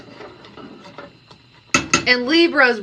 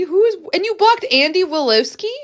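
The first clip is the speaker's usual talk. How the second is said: ordinary talk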